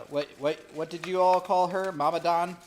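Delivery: loud call across the room. Real, not acted. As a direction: call out loudly to someone nearby. Calling out to someone off-mic.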